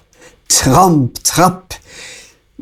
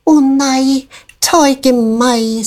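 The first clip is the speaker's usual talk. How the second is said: much higher, slow begging voice; the small goat begs the troll to spare him; pleading little goat; 9 semitones higher than usual. A narrator's reading. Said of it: "sa Klimasmart".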